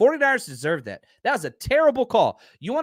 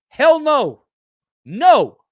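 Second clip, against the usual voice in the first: higher, louder, flat-out refusal; emphatic shout; 7 semitones higher, 9 dB louder, much slower than usual. Real, not acted. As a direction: shut the idea down hard. Answering own rhetorical question.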